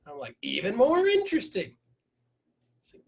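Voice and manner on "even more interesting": high, stretched-out playful interest; exaggerated, high-pitched intrigue; mounting intrigue. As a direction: ham up the growing curiosity. Tops own intrigued reply.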